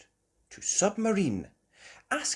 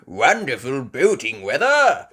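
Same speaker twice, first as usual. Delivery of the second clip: put-on louder, hearty old grandpa; cheerful old sailor; Grandpa Pig voice; 4 semitones higher, 11 dB louder than usual. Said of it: "Grandpa Pig said".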